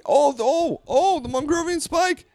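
high, loud excited yelp; the fake spokesman reliving his spike; mock excited yell. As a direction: yell like he's spiking the ball. Acting out a spike.

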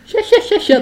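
high cackling hya-hya laugh; imitating her cackling laugh. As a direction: copy a shrill giggle. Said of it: "りほっちの笑い声とかさ".

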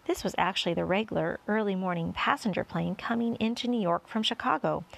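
ordinary delivery, nothing marked

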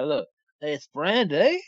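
slow, drawn-out, lighter creep; mock creepy voice. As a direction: stretch "Brandy" slowly with a rise. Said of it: "I'll do it this way".

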